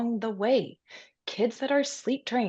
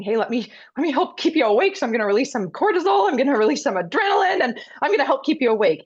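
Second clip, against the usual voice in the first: high, loud, rapid-fire eager helper; chirpy, hurried voice, pitched way up; eager helpful body; 8 semitones higher, 9 dB louder, faster than usual. Acted. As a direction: play an overtired body rushing to help. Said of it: "their body is saying".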